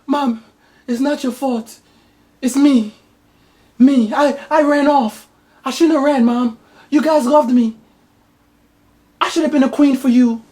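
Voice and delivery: high, hammed-up tearful daughter; daughter's voice. Daughter begging her mother's forgiveness, high and hammed-up. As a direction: sob it out like a soap heroine. Daughter replies in movie parody.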